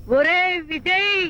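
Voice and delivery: long drawn-out call. Acted. Man hails his friend Vijay, drawn-out call. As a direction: hail a friend, stretching the name slowly.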